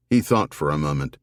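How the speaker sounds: usual speaking voice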